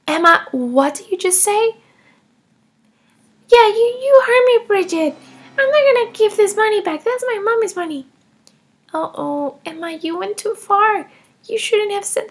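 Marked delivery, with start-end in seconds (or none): 3.5-8.1 put-on higher, louder defiant child voice; bratty child. Emma taunting Bridget, higher and louder. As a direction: taunt her like a spoiled kid.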